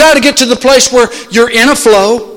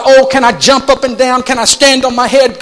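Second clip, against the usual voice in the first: usual speaking voice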